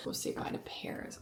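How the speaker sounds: soft unvoiced whisper to the dog. Real, not acted. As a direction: whisper a goodbye. Whispering goodbye to her dog.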